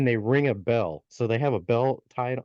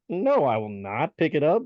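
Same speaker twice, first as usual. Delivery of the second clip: higher-pitched, indignant mock refusal; defiant refusal; 5 semitones higher than usual. Youngest brother refusing the gnome's order, defiant. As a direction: push back hard against the order.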